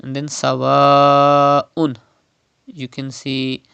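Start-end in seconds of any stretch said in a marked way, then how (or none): 0.3-2.0 long stretched "sawa'un"; elongated madd. Shown as a demonstration. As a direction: hold the second vowel of "sawa'un" for five counts, then a catch. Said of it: "stretch up to five harakat".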